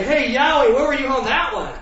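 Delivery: playfully indignant yell at heaven; half-laughing mock protest to Yahweh; mock complaint to God. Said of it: "I was like".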